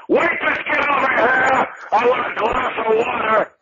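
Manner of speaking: mock yell. Imitating himself being loud, shushed.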